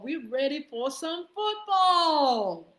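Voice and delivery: loud, slow, high-pitched catchphrase; hype shout. Real, not acted. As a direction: belt it out like a stadium announcer.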